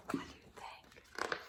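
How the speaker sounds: hushed secretive read; whisper. Real, not acted. Reader whispering to the children about the shed.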